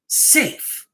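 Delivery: safe, whispered on its own; a hushed, spooky safe; whispered word. A narrator's reading. Emphasised word, creepy story.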